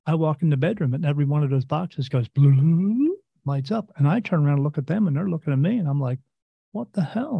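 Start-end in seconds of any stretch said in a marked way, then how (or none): 2.3-3.1 rising bloop; imitated bloop. Boxes lighting up, sound imitated. 6.7-7.4 high startled question; surprised. Retold or imitated. Retold shock at lights.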